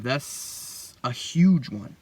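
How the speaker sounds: very slow, weighty emphasis; drawn out. Real, not acted. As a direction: stretch each word out slowly. Stressing sexual drive as an urge.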